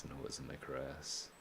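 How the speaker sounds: "And who was in my caress?" whispered and hushed. Shown as a demonstration. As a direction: breathe "And who was in my caress?" as a soft echo. Echoes the line in a whisper.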